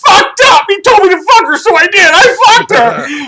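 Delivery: high, loud hammed-up outburst; over-the-top yelled admission; shouted confession. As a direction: wail it loudly, in mock despair. Shouted mock confession, accused of treachery.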